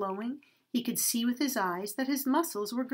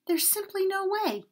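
much higher, panicky refusal; dreadful cry; 10 semitones higher than usual. Acted. Donkey refusing the man, crying out in dread. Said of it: "cried the donkey with dread".

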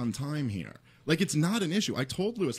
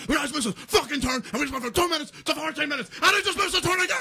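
frantic high mock rant, building louder; imitated yelling; 11 semitones higher, 4 dB louder than usual. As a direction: yell it like someone losing his mind on the phone. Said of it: "Lewis has to yell".

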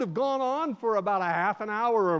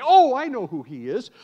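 eager reply; 5 semitones higher, 10 dB louder than usual. Acted. Villager voiced in story.

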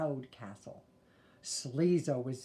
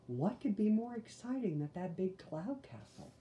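ordinary delivery, nothing marked

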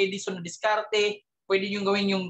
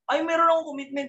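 higher, fast indignant protest; mock defensive protest; 7 semitones higher, faster than usual. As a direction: blurt it out, higher and fast, like a recruit caught out. Quoting a defensive recruit. (mabilis at mataas na pagtatanggol, parang nahuling recruit)

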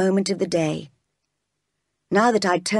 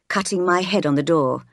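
ordinary delivery, nothing marked

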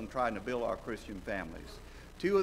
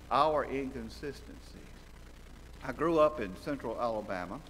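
ordinary talk

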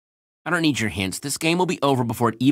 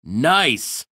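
normal talking voice

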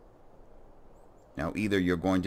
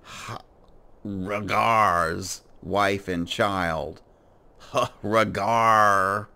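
slow, loud, drawn-out emphasis; dramatic reading; 3 semitones higher, 6 dB louder, much slower than usual. Acted. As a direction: read it out like a shocked announcement. Dramatic reading of quest text.

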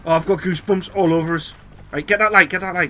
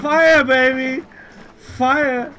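gleeful; 8 semitones higher, 3 dB louder, much slower than usual. Real, not acted. Gleeful with flamethrower weapon.

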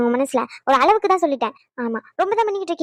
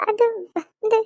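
higher, faltering tearful voice; 6 semitones higher, much slower than usual. Acted. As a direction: try to speak while crying. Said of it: "இவ என்னாச்சுன்னு அழுகுறமா நீ".

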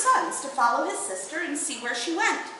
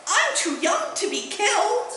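higher and slow, an anxious dragon; frightened dragon cry; 6 semitones higher, 3 dB louder than usual. A narrator's reading. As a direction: plead in mock panic, drawn out. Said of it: "cried the dragon".

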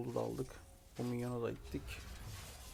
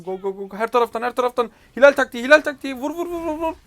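higher, much louder battle yelling; real shouting; 12 semitones higher, 16 dB louder than usual. Real, not acted. Rallying his team into the teamfight, shouting at full force. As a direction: yell the team into the fight. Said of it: "Bağıttırıyor beni".

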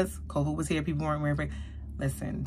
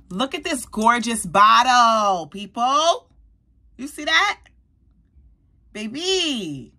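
much higher and louder, slow and swooning; gushing; 5 semitones higher, 6 dB louder, slower than usual. Real, not acted. Showing viewers the gold bottle, a high loud gush. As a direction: show off a treasure.

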